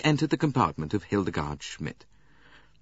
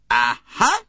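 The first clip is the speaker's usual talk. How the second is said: triumphant aha; 13 semitones higher, 9 dB louder than usual. A narrator's reading. Poirot finds the uniform.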